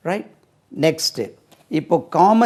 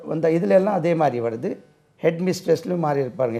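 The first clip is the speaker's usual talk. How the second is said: ordinary delivery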